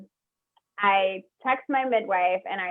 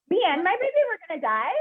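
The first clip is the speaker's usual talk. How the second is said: shocked; 10 semitones higher, faster than usual. Retold or imitated. Retells shock at nurse's words.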